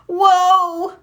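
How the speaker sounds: high, loud surprised cry; startled whoa. Acted. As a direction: yelp as you fall. Marshall tumbles.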